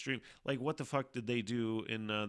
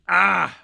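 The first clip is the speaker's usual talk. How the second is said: a sudden, very loud sharp cry; sudden loud yell; 8 semitones higher, 17 dB louder than usual. Real, not acted. Streamer suddenly yells mid-stream, very loud cry. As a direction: blurt out one loud raw yell. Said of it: "I get a little loud sometimes".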